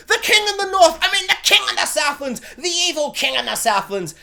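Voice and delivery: high, loud hammed-up hero yell; mock hero shout. Mocking the show's villain, high hammed-up hero shout. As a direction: ham up a pompous king's battle cry. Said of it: "he's all like".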